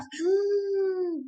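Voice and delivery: sharp excited gasp; high hopeful gasp. Pretend. Teacher gasping to students in mock delight. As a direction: gasp as if thrilled by the answer.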